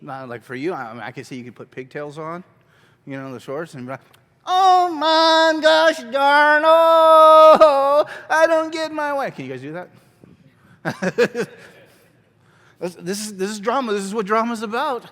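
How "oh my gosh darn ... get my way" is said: put-on high, loud, drawn-out child tantrum; bratty kid. Shown as a demonstration. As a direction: wail like a kid not getting their way. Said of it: "how do kids act".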